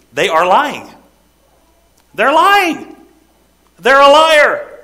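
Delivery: rising to a high, loud shout; preacher roaring that they are liars; shouted verdict. Acted. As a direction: hammer it home at the top of your voice. Preacher thundering John's verdict.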